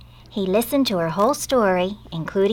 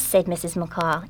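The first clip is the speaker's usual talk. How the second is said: ordinary delivery, nothing marked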